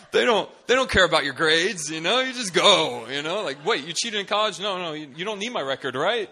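high, loud, hammed-up reasoning; frantic self-persuasion. Panicked student imagining missionary life, comically frantic. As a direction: talk yourself into it loudly.

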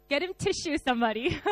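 teary laugh, voice high and catching; laughing through tears. Real, not acted. Preacher to congregation, laughing through real tears. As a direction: laugh while choking up a little. Moved to tears during sermon.

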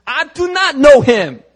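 slow, high, loud denial; acted emphatic denial. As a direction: shout it slowly, every word insisted on. Peter's acted angry denial.

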